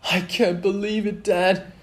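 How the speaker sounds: put-on high, loud boyish excitement; excited Milo. A narrator's reading. Milo to his dad on going to the game, high and excited. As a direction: squeal it with delight.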